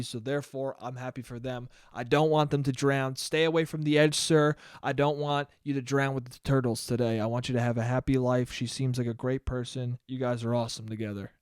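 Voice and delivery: soft, low reassuring inner voice; low kindly self-talk. Acted. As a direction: talk yourself into goodwill, quietly and low. Voicing forced kind thoughts toward couple.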